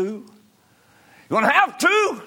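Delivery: very high, loud cry; shouted preaching. Real, not acted. Preacher hammers the congregation about their records, shouting high and loud. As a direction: repeat it as a full-force holler.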